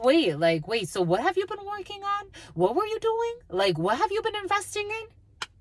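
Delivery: very high-pitched, probing questions; mimicked puzzled questioning. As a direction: imitate baffled people starting to ask questions. Voices people questioning the man.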